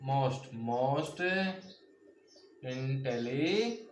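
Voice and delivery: most repeated, then intelligent stretched very slowly with a rise; slow model answer. Shown as a demonstration. Teacher slowly modelling the superlative form. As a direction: draw out the superlative for the class. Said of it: "और इसमें क्या लग जाएगा".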